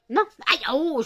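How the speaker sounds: sudden high, loud yelp of pain; ouch. Real, not acted. Owner scratched by her cat, sudden pained yelp. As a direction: yelp as claws catch you.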